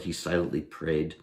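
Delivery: usual speaking voice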